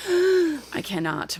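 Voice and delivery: sucked-in breath of disbelief, words slowed right down; exasperated gasp. Real, not acted. Yankees fan despairing to podcast hosts, exasperated gasp. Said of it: "I can't".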